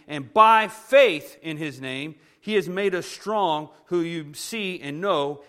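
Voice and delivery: slow, loud, weighty proclamation; solemn preacher reading, slowed down; slow scripture reading. Acted. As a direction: let each phrase land deliberately. Reads scripture slowly.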